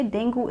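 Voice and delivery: dengue said as "DENG-goo"; wrong dengue. Shown as a demonstration. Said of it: "is not the right pronunciation".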